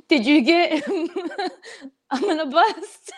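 giggling through the words; laughing. Real, not acted. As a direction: laugh while asking. Laughing question to co-host.

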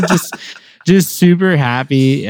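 ordinary talk, nothing marked

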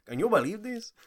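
high mock waiter voice; put-on waiter voice. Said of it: "Why do you have to have that voice?".

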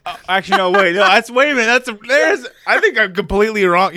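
hammed-up panicked correction; mock flustered. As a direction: scramble to take it back.

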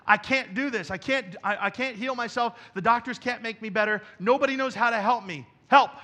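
insistent, high and loud plea; voiced cry of helplessness; desperate plea. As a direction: plead as someone out of options. Acted plea of desperate sick woman.

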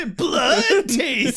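slow crying growl; mock vampire croak. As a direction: drag it out hammy.

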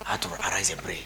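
whispered mock holiness; whispered piety. Pastor mocking a prophet's dawn message, whispered. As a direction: hush it like a secret vision.

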